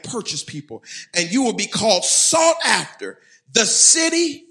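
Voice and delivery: loud, high pulpit proclamation; emphatic, ringing preacher's reading; preached scripture reading. Real, not acted. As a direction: declare the verse over the congregation. Proclaims the final verse.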